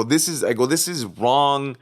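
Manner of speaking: imitating an indignant objection; retold rising anger. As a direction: reenact growing frustration, drawing out the last word. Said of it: "I started getting angry".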